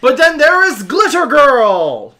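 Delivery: high, very loud introduction; big announcer shout. Acted. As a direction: announce the next act at full volume. Character announces next member.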